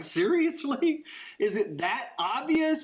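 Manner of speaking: high, drawn-out incredulous protest; mock disbelief. Southerner answering the waitress who spotted his accent, mock disbelief.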